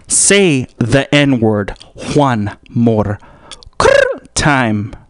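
very slow, each word stretched apart; slow drawn-out threat. Acted. Stretching each word to menace golf buddy.

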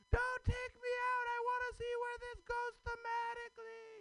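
squeaky silly voice. Acted. Comic opening his set with a squeaky silly character voice. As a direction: play a giddy squeaky audience member. Comedian opens with a put-on voice.

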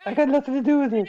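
put-on high, fast girly voice; man doing a woman's voice. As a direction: squeak it out like a girl. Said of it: "Listen to his girl voice".

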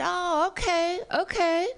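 high-pitched imitated acknowledgement; warehouse guy. Warehouse guy hearing she is Dutch, high reaction. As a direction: play the stranger taking it in, high-voiced.

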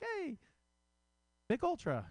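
squeaky high cheesy ad bro; hammed-up commercial hunk. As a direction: shout the brand like a beefy beer-ad guy. Ad guy with abs showing off.

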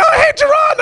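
high shouted hello; loud screech. Retold or imitated. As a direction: shriek the wrong name cheerfully. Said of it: "And they're like".

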